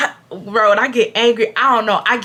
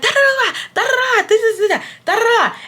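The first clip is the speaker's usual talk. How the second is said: high, taunting nonsense syllables; mocking babble; 13 semitones higher than usual. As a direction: talk over him like a child. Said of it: "And I'm like".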